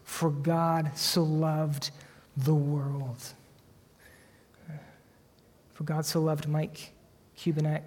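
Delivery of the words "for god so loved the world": slow tender reading. Shown as a demonstration. Pastor unveiling the verse as light after night, slow and tender. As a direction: linger on every word like dawn light. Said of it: "what is the ray of light".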